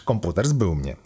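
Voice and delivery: plain reading voice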